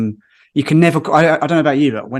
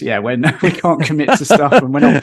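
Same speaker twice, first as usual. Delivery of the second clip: laughing through the words, higher; amused admission; 4 semitones higher, 7 dB louder than usual. Real, not acted. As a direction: own up to it with a laugh. Laughing at own flakiness.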